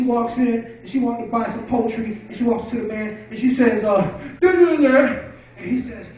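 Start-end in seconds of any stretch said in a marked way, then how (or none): 4.4-5.1 garbled mumble. Acted. Woman speaks unintelligibly in joke.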